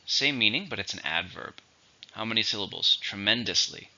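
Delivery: ordinary talk, nothing marked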